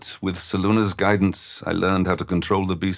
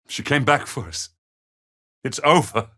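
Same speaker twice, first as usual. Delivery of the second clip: higher voice, flooded with relief; overjoyed relief; 7 semitones higher than usual. Acted. Father tells Em their daughter came back, overjoyed relief. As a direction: let the relief lift your voice. Rejoices at being rescued.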